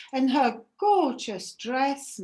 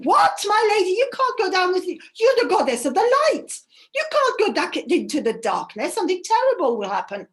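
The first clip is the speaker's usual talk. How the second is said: alarmed servant; 5 semitones higher, 6 dB louder, faster than usual. Acted. Servant alarmed at her plan.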